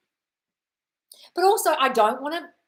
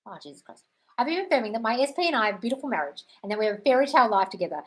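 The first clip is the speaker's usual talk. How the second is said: usual speaking voice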